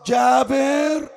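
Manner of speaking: high, drawn-out call of the name. A narrator's reading. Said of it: "صاح".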